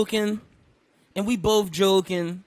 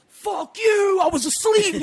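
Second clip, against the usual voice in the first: loud, high angry snap; angry fuck you; 10 semitones higher, 6 dB louder, slower than usual. Shown as a demonstration. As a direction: shout it like an insult. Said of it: "It wasn't like".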